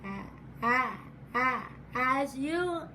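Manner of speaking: repeating the first sound; real stutter on as. Real, not acted. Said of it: "as a stutterer".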